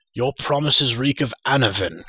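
loud, heated rejection; scornful cry. A narrator's reading. Gwydion interrupts Akron's promise, loud scornful cry. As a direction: cut in loudly with contempt. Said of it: "cried Gwydion".

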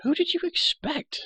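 put-on very high-pitched, impatient girl's comeback; tart retort. A narrator's reading. Girl emerging from the floor to startled prisoner, tart retort. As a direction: toss it back as if obvious.

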